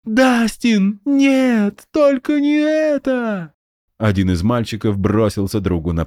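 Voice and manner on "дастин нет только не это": high kid in mock despair; playacting child. A narrator's reading. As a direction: wail it like a playground drama.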